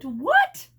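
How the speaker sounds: high, loud startled cry; exclaiming. A narrator's reading. Charlie shocked at the landing, loud surprise. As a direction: blurt it out shocked. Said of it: "Charlie exclaimed".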